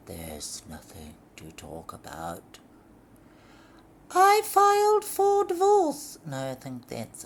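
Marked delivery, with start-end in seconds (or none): 0.1-2.6 lower, deadpan man's voice; flat. Acted. Husband shutting Lorraine down, low and deadpan. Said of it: "How do you say that flatly?". 4.1-6.2 high, loud falsetto lady; shrill diva. Acted. Lorraine announcing divorce to her husband, shrill.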